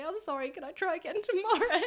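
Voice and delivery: high, loud, laughing sorry; laughing apology. Retold or imitated. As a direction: sheepishly laugh out the request. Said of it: "and say".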